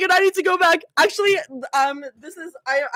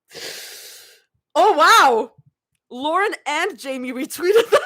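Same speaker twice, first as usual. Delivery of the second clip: ordinary talk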